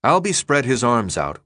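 plain reading voice

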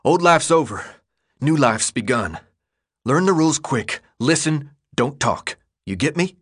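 firm leader giving terse rules; tough gang leader. Acted. Leader giving the newcomer the rules, stern tough voice. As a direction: lay down the law, in character.

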